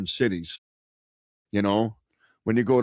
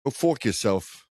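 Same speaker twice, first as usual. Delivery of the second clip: mock New York accent; 2 semitones higher, slower than usual. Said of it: "they do a weird thing with the A".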